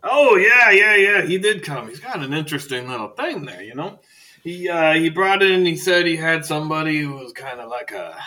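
[name] chatty. Acted. Librarian telling Archibald about Thomas, eccentric old man. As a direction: ramble like a gossiping old scholar.

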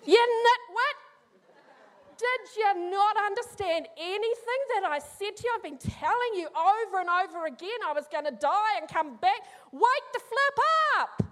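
preacher playing Jesus scolding Thomas in jest; exasperated Jesus. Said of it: "Then I probably would have gone".

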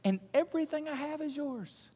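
quiet, gentle promise; soft reassurance. Acted. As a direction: tell him softly it is all his. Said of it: "Look at this".

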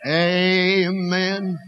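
ordinary talk

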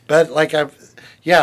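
usual speaking voice

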